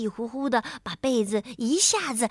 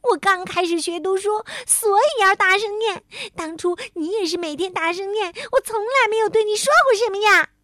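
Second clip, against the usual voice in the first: high, loud wounded protest; upset outburst; 8 semitones higher, 5 dB louder, faster than usual. A narrator's reading. Tiaotiao defends learning to read, upset. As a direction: argue back, upset and indignant. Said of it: "可真急了".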